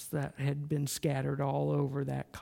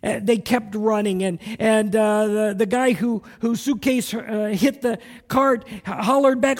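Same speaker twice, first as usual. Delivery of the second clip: loud, high-pitched preacher storytelling; big sermon-story delivery; preaching at full force; 5 semitones higher, 10 dB louder than usual. Acted. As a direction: drive the story home from the pulpit.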